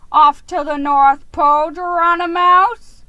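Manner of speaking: loud, high teasing jab; mock him with a grin in the voice; smirking. A narrator's reading. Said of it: "he smirked".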